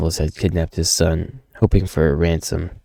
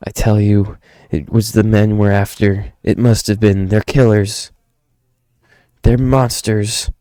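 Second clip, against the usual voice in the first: insistent character voice, pitched up; urgent accusation, higher and pressing; desperate father, agitated; 4 semitones higher, 5 dB louder than usual. Acted. Father blaming the kidnappers for the slaughter, high agitated voice.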